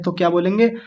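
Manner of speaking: ordinary talk, nothing marked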